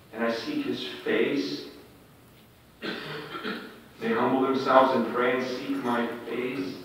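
normal talking voice

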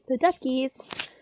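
ordinary delivery

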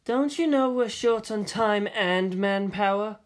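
slow, cool, lightly voiced impatient woman; flat Ritsuko. A narrator's reading. Ritsuko confronting Misato at the elevator, flat and slow. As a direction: say it flatly and slowly. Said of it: "she said flatly".

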